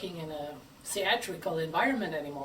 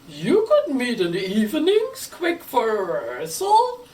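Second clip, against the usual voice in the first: louder questioning listener voice; imagined listener; 3 semitones higher, 7 dB louder than usual. Pretend. Speaker voicing a doubtful listener's objection, louder. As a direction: play the doubtful listener, pushing back. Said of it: "you may ask".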